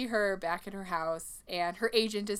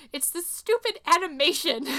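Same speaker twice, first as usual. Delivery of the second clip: tearful protest; 8 semitones higher, 5 dB louder than usual. Retold or imitated. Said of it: "And she's like".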